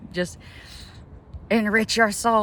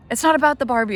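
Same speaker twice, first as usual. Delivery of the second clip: higher, louder, fired-up insistence; excited emphasis; 7 semitones higher, 6 dB louder than usual. Real, not acted. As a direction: hammer the point home excitedly. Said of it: "I'm getting too excited".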